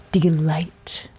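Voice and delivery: delight as a hushed, admiring whisper. Retold or imitated. Naming his new nickname.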